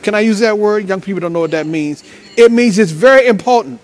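usual speaking voice

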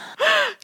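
squealing laugh; high burst of laughter. Real, not acted. Laughing at Disney joke.

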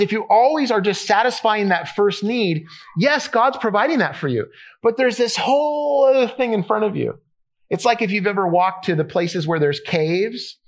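ordinary talk, nothing marked

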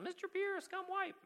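very high mock holler; imitated child's yell. Recalling what the boy yelled in public, imitated child's yell. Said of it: "he's just been yelling".

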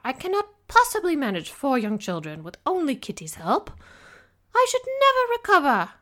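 high, loud, fretful complaint. A narrator's reading. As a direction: wail about her nerves, loudly. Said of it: "Missus Bennet's shrill voice raised".

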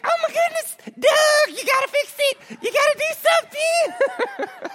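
hammed-up high, squealing panic; freaking-out mom. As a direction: imitate a frantic mother begging her husband to act. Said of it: "She is freaking out".

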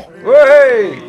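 a presenter's mock cheer over the results.

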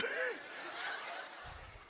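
soft, high-pitched wheeze of laughter. Real, not acted. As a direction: laugh high and airy at your own joke. Laughs after hundreds joke.

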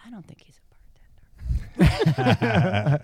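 slow, laughing whisper; whispered aside. Retold or imitated. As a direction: breathe it out as a slow secret.

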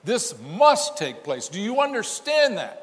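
high, loud, pressing; rising preacher's appeal; preaching loudly. Real, not acted. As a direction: drive it at the congregation. Preacher presses the point home.

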